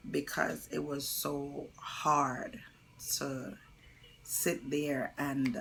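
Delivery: slow breaking whisper; slowed, whispered, holding back tears; choked whisper. Real, not acted. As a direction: voice gives out, real grief. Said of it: "recalling pushing dead baby".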